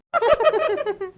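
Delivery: a squeaky high-pitched giggle; squeaky gleeful giggle. Acted. High laugh after singing.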